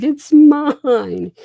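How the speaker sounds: put-on high, loud whiny little girl voice; mimicking his daughter. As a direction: squeak it out like a pouting six-year-old. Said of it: "'cause she was like".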